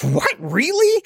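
startled question leaping very high; overreacting outburst. Re-enacting an embarrassing reaction in the shop, shocked outburst. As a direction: squeak it in total disbelief. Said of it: "I felt embarrassed by my outburst".

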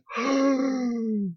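shocked gasp. Retold or imitated. Shocked at the racist outburst.

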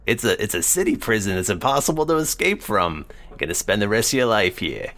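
lightly voiced weaselly cellmate; a hint of a sly lizard prisoner; feral wasteland raider. Dragonborn cellmate talks.

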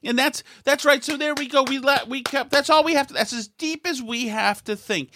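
high mocking voice, eager agreement; sarcastic high agreement. Podcaster mocking opponents' shallow thinking, high sarcastic voice. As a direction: parrot them with fake enthusiasm.

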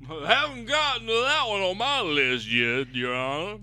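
Southern lawyer addressing the judge; playing along in Southern courtroom style. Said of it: "sassy Southern lawyer".

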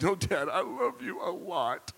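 butter Dad up with exaggerated love; laying it on. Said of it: "I mean, I was putting it on".

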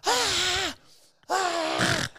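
very high retold shriek; thin high-pitched mock wail; mock child's scream. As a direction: imitate a screaming kid without full volume. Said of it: "I just start screaming".

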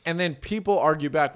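usual speaking voice

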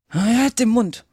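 high, loud silencing order; brusque interruption. A narrator's reading. Griffin cutting Alice off before she can answer, brusque and loud. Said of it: "fügte der Greif hinzu".

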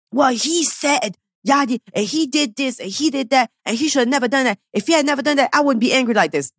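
high mocking voice of someone blaming their partner; mock whiny excuses. Host mimicking an angry viewer blaming her partner, high mocking voice.

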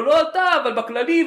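high, slow mocking passenger; hammed-up clarification. Passenger's reply.

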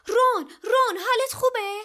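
very high, loud cry of alarm; panicked shrieking. A narrator's reading. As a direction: scream out to check on a hurt friend. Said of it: "هرماینی با جیغ و داد گفت". (با جیغ و داد و نگرانی)

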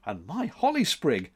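usual speaking voice

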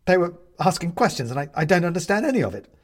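high, loud, flustered rush; at his wits' end. A narrator's reading. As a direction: blurt it out, overwhelmed. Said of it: "coming to the end of his ability to deal with it".

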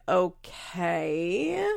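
drawn out with a long questioning rise; slow, rising mock doubt; doubtful okay. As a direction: stretch it upward, imitating skepticism. Acts out Kale's doubtful reply.

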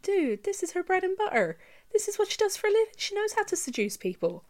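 high-pitched incredulous objection; mock pleading with a clueless character; exasperated protest. Acts out exasperated reaction to film.

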